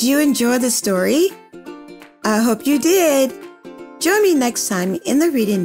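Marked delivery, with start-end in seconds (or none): none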